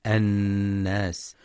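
الناس with the doubled ن hummed long through the nose; nasal ghunna. Shown as a demonstration. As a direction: hold the nasal hum on the ن of الناس, slowly. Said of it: "لغنة النون المشددة". (غنة النون في الناس)

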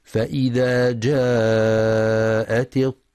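slow, drawn-out tajweed chanting; measured melodic Quran recitation. Shown as a demonstration. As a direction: recite with full ghunna and long vowels. Recited verse.

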